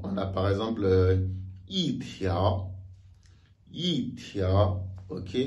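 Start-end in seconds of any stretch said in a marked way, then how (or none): 1.7-2.7 "i tiao": falling "i", rising "tiao"; fourth-tone yi. Shown as a demonstration. Teacher shows second-tone case, "i" falling before rising "tiao". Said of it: "accompagné du deuxième ton". 3.7-4.8 "i tiao": falling "i", rising "tiao"; fourth-tone yi. Shown as a demonstration. Teacher shows second-tone case, "i" falling before rising "tiao". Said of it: "accompagné du deuxième ton".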